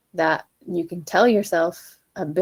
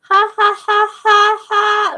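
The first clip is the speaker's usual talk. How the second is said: pinched ha, much higher and louder; tight squeaky ha; 13 semitones higher, 10 dB louder, slower than usual. Shown as a demonstration. Coach demonstrating the squeaky tight ha. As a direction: strain the ha up high. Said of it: "that kind of sound".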